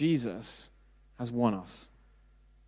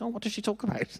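clueless younger self; 8 semitones higher, 6 dB quieter, faster than usual. Retold or imitated. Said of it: "And I was like".

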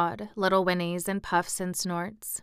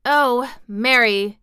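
loud, overcome cry; half-sobbing cry; 5 semitones higher, 8 dB louder, much slower than usual. A narrator's reading. Colin overwhelmed by Mary's news, half-sobbing cry. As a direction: cry out as if near tears. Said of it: "he cried out with a half sob".

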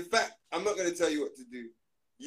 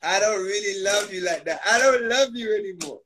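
mock breakup; 2 semitones higher, 8 dB louder than usual. Breaking up with banana.

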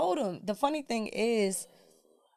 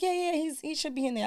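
higher, easygoing dad; light father voice; 7 semitones higher, 3 dB quieter than usual. Retold or imitated. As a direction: answer casually. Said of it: "He was like".

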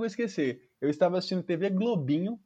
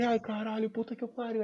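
dreading murmur; 3 semitones higher, 7 dB quieter than usual. Retold or imitated. Boy dreading undressing.

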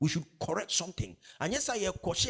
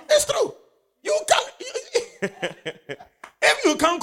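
much higher, laughing preacher cry; 6 dB louder than usual. Real, not acted. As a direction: crack up into a high call.

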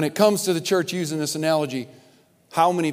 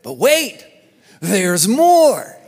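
home shopping host; 3 semitones higher, 7 dB louder, much slower than usual. Retold or imitated. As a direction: sell it like a TV pitchman. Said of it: "He coined a phrase".

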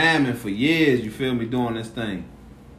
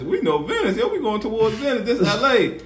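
higher-pitched, mildly excited quote; excited retelling; 7 semitones higher than usual. Retold or imitated. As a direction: act out growing excitement on the drive. Retelling excited realization on drive.

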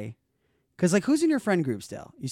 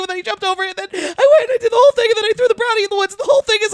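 very high, loud, fast, teary babble; frantic crying confession; 8 dB louder, faster than usual. Retold or imitated. Scene: busted stoned teen confessing to mom and Al, high loud sobbing babble. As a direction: blurt it all out in a sobbing panic.

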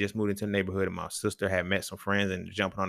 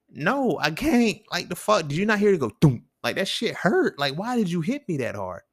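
acted-out hurt and outrage, pitched up; pained protest; 7 semitones higher, 3 dB louder than usual. Man answering the girl who hit him, indignant protest.

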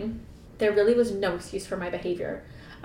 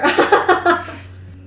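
loud, high burst of acted laughter; mock roaring laugh; hammed-up roar of laughter; 10 semitones higher, 10 dB louder than usual. A narrator's reading. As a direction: guffaw like he can't hold it in. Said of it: "he broke into a roar of laughter".

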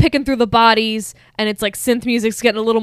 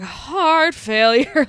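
"Heart failure." dragged out very slowly, rising at the end; rattled, slow repeat; 4 semitones higher, much slower than usual. Retold or imitated. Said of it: "he's really rattled".

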